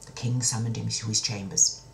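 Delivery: usual speaking voice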